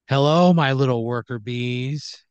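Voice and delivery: stretched-out hello to the little workers; boss greeting workers. Acted. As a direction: greet the underlings like a patronizing boss. Owner greets her tiny workers.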